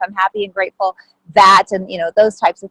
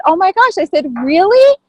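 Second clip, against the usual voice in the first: much higher, delighted really; astonished; 9 semitones higher than usual. Retold or imitated. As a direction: gush in happy disbelief. Retelling her disbelief.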